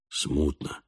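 very low, hushed reply; subdued. A narrator's reading. Falk dimly grasps what the boy pleads, hushed and low. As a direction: answer quietly, sunk far down.

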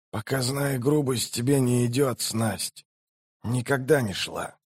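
soft, weakened man's voice; dying mage. A narrator's reading. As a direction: chide her quietly with little strength left. Dying mage chides sorceress. (тихий упрёк умирающего мага)

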